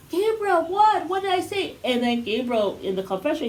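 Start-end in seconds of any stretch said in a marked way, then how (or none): 0.1-1.8 higher, puzzled mock call after him; baffled Cody. Podcaster mimics Cody calling after his walking son, higher. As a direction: play the clueless dad calling out. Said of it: "And he's like".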